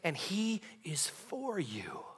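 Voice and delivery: fervent sermon climax; preaching at full force. Acted. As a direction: lift the room with it.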